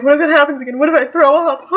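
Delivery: high, loud mock fretting; retold anxious worry. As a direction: act out rising what-if panic. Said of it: "I was a bit more cautious".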